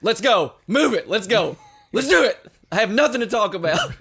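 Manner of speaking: high, loud impatient ordering; bossy bully. Retold or imitated. Husband mimics his wife hurrying everyone upstairs, loud bossy mimicry. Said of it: "And then she's like".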